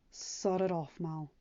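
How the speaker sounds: gently. Acted. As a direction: nudge her gently, quiet and kind. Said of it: "he said gently".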